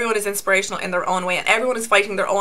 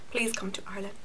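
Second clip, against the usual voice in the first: breathy whispered plea to a brand; 2 semitones lower, 13 dB quieter, faster than usual. Real, not acted. Whispering a plea to Tarte to come. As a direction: whisper it like a secret wish.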